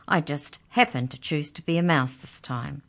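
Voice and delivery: plain reading voice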